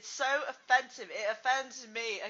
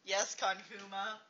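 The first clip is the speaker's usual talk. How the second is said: usual speaking voice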